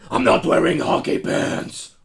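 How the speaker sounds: loud, slow, rasping impression; mock-heroic movie tough guy; film-quote growl. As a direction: bellow it slowly in a rough whisper. Quotes a Batman film line in costume voice.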